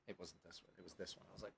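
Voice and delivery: ordinary delivery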